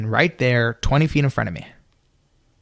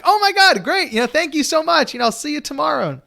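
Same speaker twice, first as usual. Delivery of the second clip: much higher, gushing thanks; thrilled; 13 semitones higher, 3 dB louder than usual. Retold or imitated. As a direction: burst out in delighted high-pitched thanks. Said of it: "I'm like".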